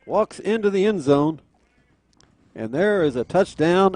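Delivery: loud, excited scoring call; touchdown call. Real, not acted.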